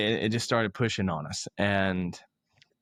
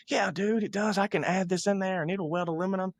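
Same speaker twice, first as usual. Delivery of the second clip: salesman's pitch pitched up high and eager; 8 semitones higher than usual. Retold or imitated. Pushy salesman assuring young buyer his welder does aluminum, eager and pitched high. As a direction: sell it hard, all yes-man enthusiasm.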